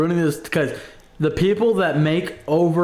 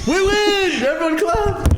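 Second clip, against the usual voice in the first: high cheering cry; mock victory cheer; slower than usual. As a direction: cheer like you won a prize.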